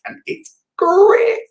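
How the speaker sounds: high, slow squeal on great; squeaky high exclaim. Acted. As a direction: squeak it out comically.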